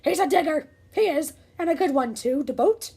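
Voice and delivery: loud high pirate call; captain calling out to his crew; pirate yell. Acted. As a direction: bellow back to the crew. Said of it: "yelled back to his crew".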